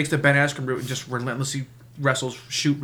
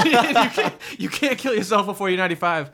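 higher-pitched, talking through real laughter; laughing; 5 semitones higher than usual. Real, not acted. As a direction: lay down the rule while cracking up. Group laughs at grim scenario.

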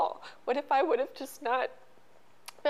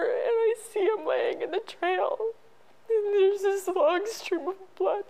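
higher, tearful, barely holding on; breaking into tears; 6 semitones higher than usual. Real, not acted. Mother recalling finding her son, voice breaking into tears. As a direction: voice cracking as she sees him on the trail.

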